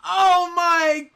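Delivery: slow, drawn-out cry of disbelief; stunned amazement. Real, not acted. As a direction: stretch each word out in awe. Disbelief at the shiny.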